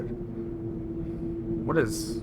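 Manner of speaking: high, stretched-out puzzled "What is"; drawn-out puzzled what. Real, not acted. Streamer puzzling at the screen, high drawn-out wonder.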